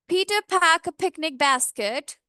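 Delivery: slow, every p popped with a burst of air; plosive demo. Shown as a demonstration. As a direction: say "Peter pack a picnic basket" slowly, popping each p. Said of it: "example के through समझते हैं".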